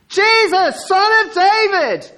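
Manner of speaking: full-voiced relentless plea; crying out. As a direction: keep hollering for attention, undeterred. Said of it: "He just carries on".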